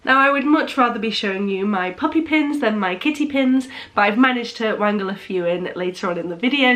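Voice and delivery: ordinary delivery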